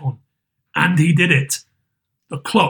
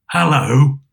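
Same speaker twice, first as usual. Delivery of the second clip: mock growl; 3 semitones lower than usual. A narrator's reading. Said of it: "growled Scrooge in his accustomed voice".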